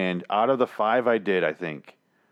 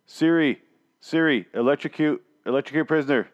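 louder, higher, impatient commands at a phone; executioner bossing Siri; 5 semitones higher, 3 dB louder than usual. Acted. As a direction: bark orders at a useless voice assistant. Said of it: "here's my impression of an executioner now".

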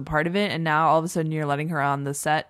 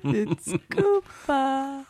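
high, very drawn-out teasing echo; playful slow, high-pitched imitation; mimicking inner nag; 8 semitones higher, much slower than usual. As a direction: stretch it out in a mock little voice. Mimics co-host's inner-voice correction.

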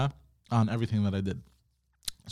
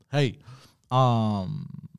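normal talking voice

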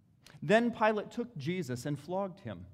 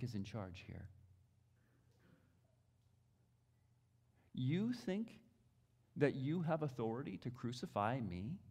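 much softer, lower rebuke; hushed challenge; 4 semitones lower, 6 dB quieter than usual. Acted. Preacher confronting Pilate on authority, low and much softer. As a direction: rebuke him in a low hush.

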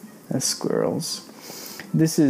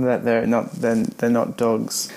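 ordinary delivery, nothing marked

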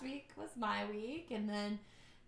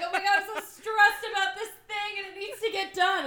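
mock stressed panic; 9 semitones higher, 8 dB louder than usual. Stressed self voiced, laughing.

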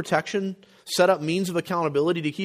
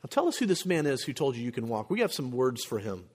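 softer, lower, restrained displeasure; understated threat, voice pulled back and lowered; mock stern interrogation; 3 semitones lower, 6 dB quieter than usual. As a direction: quietly demand the culprit's identity. Acting out the stern Pharisees instead.

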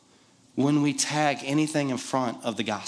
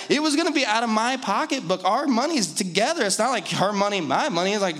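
ordinary talk

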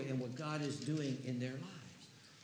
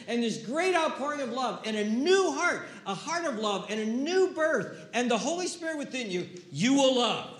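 rising, louder preaching toward the cross; impassioned preaching; 4 semitones higher, 4 dB louder than usual. Real, not acted. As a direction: build the sermon to a fervent peak. Preaching builds to climax.

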